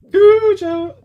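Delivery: Cujo squealed high with a laugh; mocking his own mistake; squeaky mock voice. Mocks his wrong version.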